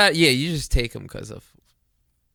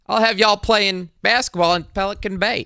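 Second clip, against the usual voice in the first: put-on Boston accent, pitched up and loud; Denzel in a Boston accent; 9 semitones higher, 5 dB louder than usual. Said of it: "do Denzel in a Boston accent".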